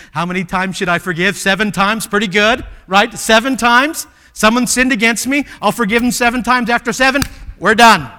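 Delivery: loud, high, self-satisfied bargaining; pleased Peter. Acted. Peter asking Jesus how often to forgive, loud and pleased. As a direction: Peter proud of his generous offer.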